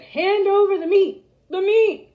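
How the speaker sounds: loud, high rowdy demand; roaring. A narrator's reading. The crew roars for meat at dinner, loud and high. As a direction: roar for the meat like hungry pirates. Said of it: "We all roared".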